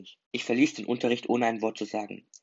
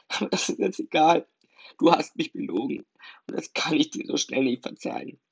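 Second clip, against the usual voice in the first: put-on higher, hurt, tearful girl's voice; 8 semitones higher, 7 dB louder than usual. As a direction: speak through tears, feeling betrayed. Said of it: "ihre langsamen Tränen".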